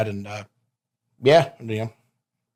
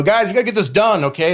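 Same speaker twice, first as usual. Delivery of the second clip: a hint of an exasperated authority figure, higher; Teddy Long getting hot; 8 semitones higher than usual. Wrestler mimics Teddy Long scolding the boys, mock exasperated. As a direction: mimic a boss losing patience, pitched up. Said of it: "if Teddy Long gets hot".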